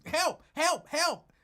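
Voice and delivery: high, loud hammed-up help help help; mock screaming for help. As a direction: squeal it out like a panicked man. Retelling Lincoln's cries for help.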